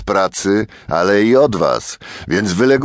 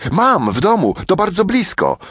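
much higher, lightly voiced eager girl; quick, keen child answering; eager little girl; 12 semitones higher than usual. A narrator's reading.

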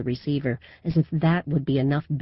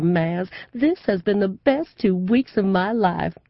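gushing southern belle; 8 semitones higher, 6 dB louder than usual. A narrator's reading. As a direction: play the Southern belle for the crowd. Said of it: "she's gone all southern".

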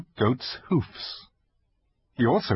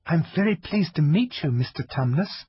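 put-on young girl voice; 7 semitones higher than usual. Lucy greets Tumnus.

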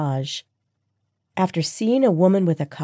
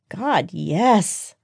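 a touch of a moan; 3 semitones higher than usual. A narrator's reading. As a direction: say it as a mild, pleasured moan. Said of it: "I moaned".

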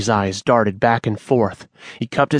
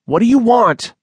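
terrified cry; 12 semitones higher, 5 dB louder than usual. Acted. Manny cornered by the snake.

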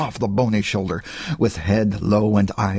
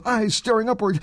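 plain reading voice

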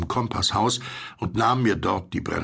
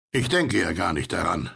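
plain reading voice

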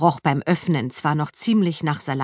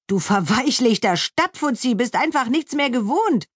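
playful teasing, higher voice; teasing joke; 7 semitones higher than usual. A narrator's reading. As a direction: rib a friend cheerfully. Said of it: "scherzte sie".

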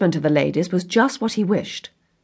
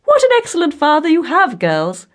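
high, loud, gushing matron; rapturous mother; 10 semitones higher, 7 dB louder than usual. A narrator's reading. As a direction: gush to your daughters, pitched up.

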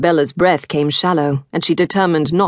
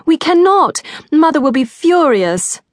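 high, loud pleading; panicked; 11 semitones higher, 4 dB louder than usual. Acted. Girl protesting in panic.

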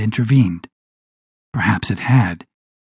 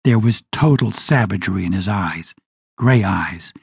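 plain reading voice

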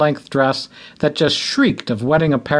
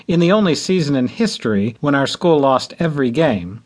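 ordinary delivery, nothing marked

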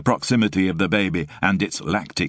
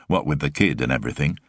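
usual speaking voice

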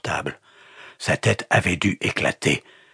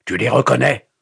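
loud, high, pressing question; angry demand; 7 semitones higher, 6 dB louder than usual. A narrator's reading. Pastor confronting the captive with icons, angry demand. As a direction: press him for an answer.

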